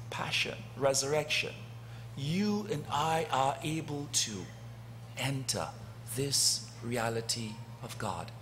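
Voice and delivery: usual speaking voice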